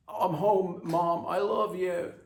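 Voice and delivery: put-on high, loud happy kid; bouncy greeting to mom; cheerful teen son.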